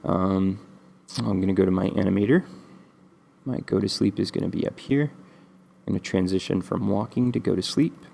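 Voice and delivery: ordinary delivery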